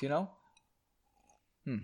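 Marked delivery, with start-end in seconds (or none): none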